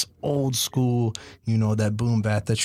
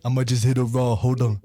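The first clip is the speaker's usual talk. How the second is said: ordinary talk, nothing marked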